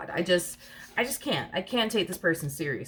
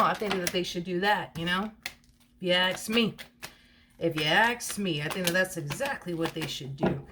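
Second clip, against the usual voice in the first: usual speaking voice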